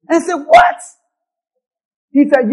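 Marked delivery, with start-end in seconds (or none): 0.5-0.8 shrieked high and loud in disbelief; a retold squeal of shock; shocked what. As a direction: jump at the news, loud and high. Shocked the fruit could kill.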